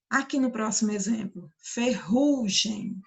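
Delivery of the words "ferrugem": "ferrugem" bem devagar, com RU forte e alongado; ênfase na sílaba do meio. Shown as a demonstration. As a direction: carregue no RU de "ferrugem", separando as sílabas. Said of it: "a sílaba do meio". ("ferrugem" pausado, com força no RU do meio)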